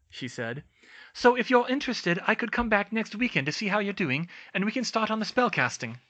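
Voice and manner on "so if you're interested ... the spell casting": higher, eager schoolgirl voice; bright and keen, offering to help; Hermione's voice. A narrator's reading. Hermione eagerly offers to teach Cedric left-handed casting, higher eager girl's voice.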